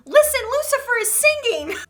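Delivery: put-on high, loud child's cry; a toddler shouting to mother; retold scream. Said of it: "I screamed to my mother".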